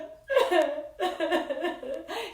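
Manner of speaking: hammed-up sobbing bear; mock bear sob. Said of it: "He whimpers and he moans".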